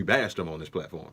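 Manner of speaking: accidental touch of Texas accent; slight Texas twang. Real, not acted. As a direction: let a little Texas slip in. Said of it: "sound like I was from Texas".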